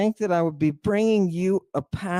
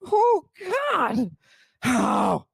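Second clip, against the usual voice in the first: exclaiming; 6 semitones higher, slower than usual. Real, not acted. Preacher thanking Photius baby, high exclamation. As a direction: exult over the find.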